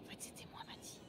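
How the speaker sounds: soft, secret whisper; whispered confession. Acted. Admitting to a friend she was the one, whispering. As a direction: confess it under your breath so nobody hears.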